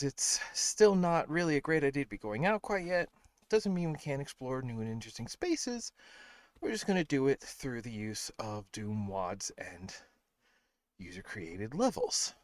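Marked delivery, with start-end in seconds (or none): none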